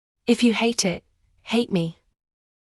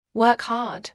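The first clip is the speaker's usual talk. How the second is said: ordinary talk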